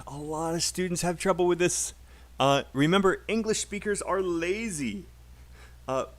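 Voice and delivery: ordinary delivery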